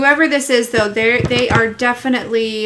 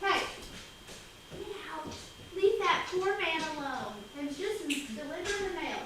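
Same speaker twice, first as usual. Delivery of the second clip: a quiet, higher scolding of the dog; hushed scolding; 5 semitones higher, 16 dB quieter than usual. Real, not acted. Shooing the dog away from the delivery man, hushed scold. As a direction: shoo the dog off under your breath.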